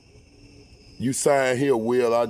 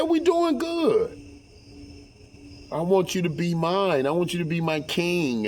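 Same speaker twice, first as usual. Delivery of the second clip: mimicking first wife; 5 semitones higher than usual. Voicing first wife's plea.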